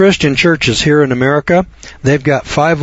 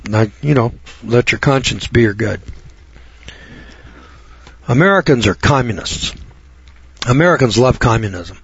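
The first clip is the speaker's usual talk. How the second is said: ordinary delivery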